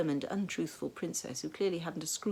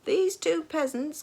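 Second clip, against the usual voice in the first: loud, high, slow pompous bellow; high volume; 12 semitones higher, 8 dB louder, slower than usual. A narrator's reading. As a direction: announce it at full volume like a drill sergeant. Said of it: "continued the sergeant at high volume".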